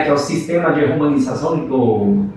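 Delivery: usual speaking voice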